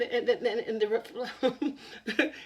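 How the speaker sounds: plain reading voice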